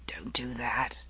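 faint, hushed plea from a sickbed; weak quiet voice. Acted. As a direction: barely voice the words. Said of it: "Lancelot said".

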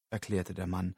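ordinary delivery

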